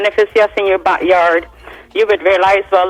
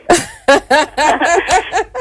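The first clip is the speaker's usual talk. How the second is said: a real, high burst of laughter; bright laugh; 8 semitones higher, 4 dB louder than usual. Real, not acted. Singer laughing at her own joke, high bright laugh. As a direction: laugh out loud, amused by yourself.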